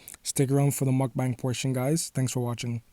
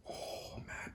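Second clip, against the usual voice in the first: whispered; 25 dB quieter than usual. Real, not acted. Awed over soft cinnamon roll.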